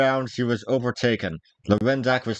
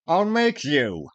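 slow, higher menacing threat; a stern youth threatening in earnest; 5 semitones higher, slower than usual. Acted. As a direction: force the words out slowly at him. Dick threatens Matcham.

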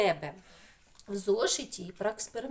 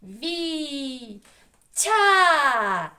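Ві and Тя held long and high, Тя much louder; syllable by syllable; 6 semitones higher, 10 dB louder than usual. Shown as a demonstration. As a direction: call out each syllable, Ві - Тя. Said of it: "Перший склад". (Ві - Тя високо, протяжно, по складах)

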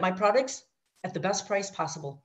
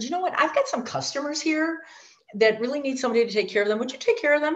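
a bit higher, quick request; lightly voiced sponsor; 7 semitones higher, faster than usual. Departing sponsor handing over customers, higher and quick. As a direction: ask a casual favour, brisk.

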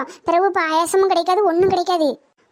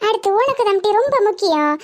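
usual speaking voice

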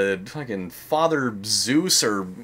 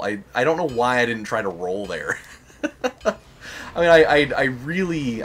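normal talking voice

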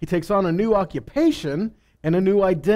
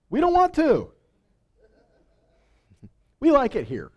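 mock whiny refusal, jumping much higher, breaking into a laugh; mankind refusing; 8 semitones higher than usual. Preacher mocking people who refuse to spread out, playful whine. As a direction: play the stubborn people saying no, half laughing.

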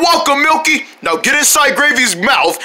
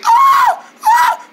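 high, loud mock "ah" squeals; mock high-pitched ah ah; imitated ah sounds; 4 dB louder than usual. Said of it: "she'll say".

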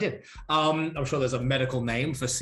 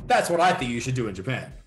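ordinary delivery

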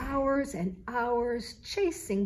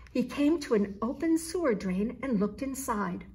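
plain reading voice

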